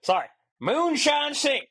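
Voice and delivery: very high, slow Southern drawl; mock Southern twang, stretched out. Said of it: "in a southern accent".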